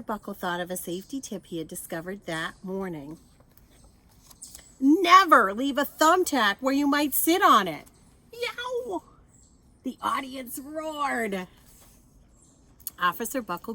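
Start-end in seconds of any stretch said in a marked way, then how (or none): 4.8-7.9 loud, higher stern warning; loud lecturing officer; emphatic safety tip. A narrator's reading. Buckle announces thumbtack tip. 8.3-9.0 very high sharp yelp of pain; squeaky comic ouch. Acted. Sitting on a thumbtack.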